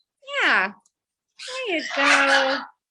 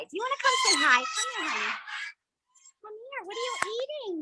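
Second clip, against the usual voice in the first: high, coaxing pet voice; 11 semitones higher, faster than usual. Real, not acted. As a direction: sweet-talk the bird over, pitched up. Coaxing a bird to come.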